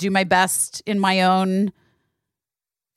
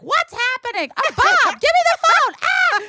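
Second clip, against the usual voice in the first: frantic high mom. Retold or imitated. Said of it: "She's like".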